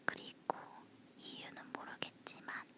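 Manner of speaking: breathy whisper close to the mic. Real, not acted. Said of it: "속닥거리는 목소리라서".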